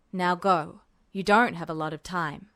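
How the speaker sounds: low, hushed officer's voice; Mustang voiced low. A narrator's reading. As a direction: keep it low and secretive. Mustang's voice in story.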